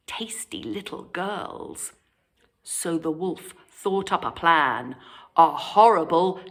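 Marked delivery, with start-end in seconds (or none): 0.1-1.9 softer sly whisper. A narrator's reading. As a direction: let the secret slip in a hush. Wolf's secret appetite.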